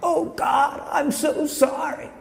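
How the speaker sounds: high, thin, drawn-out taunt; eerie imitation of a mocking voice. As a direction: mimic a prayer in a sneering falsetto. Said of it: "but in a mocking tone".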